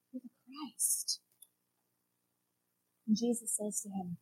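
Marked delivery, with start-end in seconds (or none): 0.1-1.2 slow, breathless whisper; awed whisper. Retold or imitated. Peter confessing to Jesus, slow awed whisper. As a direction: whisper it in awe.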